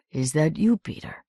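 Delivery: put-on softly accented English, voice dropping away on the name; gentle foreign-accented question. A narrator's reading. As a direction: speak English with a soft Romanian accent. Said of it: "he said in his soft accent".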